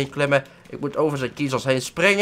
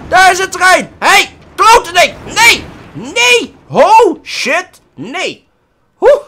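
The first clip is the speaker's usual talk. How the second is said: loud, much higher alarmed yells; panicked shouting; 13 dB louder, much slower than usual. Real, not acted. Gamer yells in panic as a train arrives. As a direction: yell at the oncoming train. Train hits him in game.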